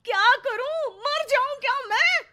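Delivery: high, loud, fast self-pitying wail; a fed-up housewife grumbling at full volume; whiny wife's complaint. Acted. Neha complains, high put-on voice. (ऊँची, तेज़ शिकायती आवाज़ में)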